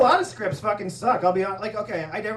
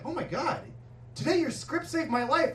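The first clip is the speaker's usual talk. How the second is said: higher mock-thrilled voice; imitating excited girl; 6 semitones higher, 4 dB quieter than usual. Girl meeting the writer who saved her, mock-thrilled. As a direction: lift the pitch in mock amazement.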